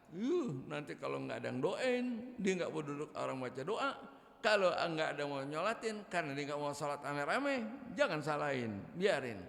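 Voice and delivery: ordinary talk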